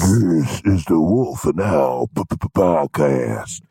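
low, slow, stuttered hype voice; deep announcer with a stutter effect. Pretend.

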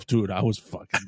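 ordinary talk, nothing marked

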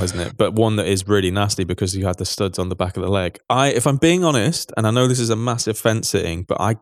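ordinary talk, nothing marked

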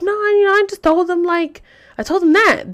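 high-pitched voice for mom explaining; retold mom. Mom answering her daughter about the letter, high explaining voice.

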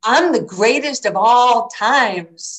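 slow, high, loud mock brag; mock boastful proclamation. Young athlete boasting to Muhammad Ali, playfully grandiose. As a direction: proclaim it like a champion.

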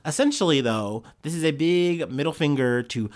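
normal talking voice

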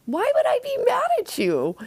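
high, sing-song fake-sweet; mock innocence dripping with condescension; sarcastic little sister voice; condescending. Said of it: "responds very condescendingly".

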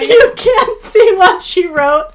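high and loud, laughing till crying. Real, not acted. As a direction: talk through tears of laughter.

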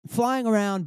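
ordinary talk, nothing marked

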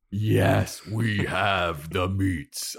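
slow, booming ad announcer impression; weighty slogan read in imitation; Ving Rhames. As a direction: draw each word out like a commercial voiceover. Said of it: "Ving Rhames and his, uh, Arby's commercials".

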